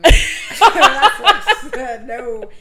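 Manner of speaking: words breaking into loud laughter; real laughter bursting between the phrases; laughing refusal. Real, not acted. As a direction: refuse while cracking up. Joking refusal, cracking up.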